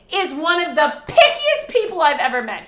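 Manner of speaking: half-laughing complaint. Real, not acted. Laughing about picky husband.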